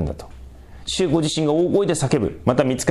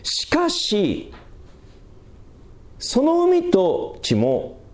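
slow, much higher, drawn-out "しかし"; preacher's dramatic turn, slowed right down; emphatic pivot; 12 semitones higher, much slower than usual. Real, not acted. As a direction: lift the turn high and let it hang. Sermon turning point emphasis.

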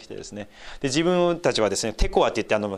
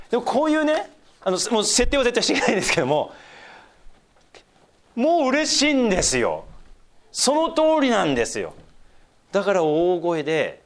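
joyful emphasis; 9 semitones higher than usual. Real, not acted. Preacher's rising enthusiasm.

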